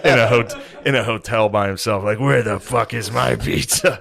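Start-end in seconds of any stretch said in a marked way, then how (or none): 2.2-4.0 slow mock demand. Retold angry hotel guest.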